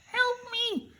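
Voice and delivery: very high, thin cry for help; storyteller voicing a high squeaky Tin Man.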